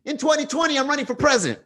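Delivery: high breathy mock [name] announcement; imitating [name]. [name] announcing his run on TV, in a high mock voice. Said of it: "[name] went on T V and goes".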